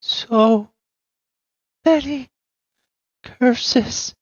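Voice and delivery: high, slow, eerie unhinged voice; raving madwoman. Acted. GM voicing the mad woman muttering about curses. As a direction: drift through the words like a lost mind.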